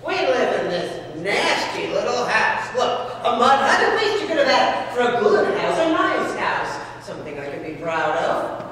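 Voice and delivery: loud, laughing scolding wife; mock the husband loudly with a laugh; nagging wife.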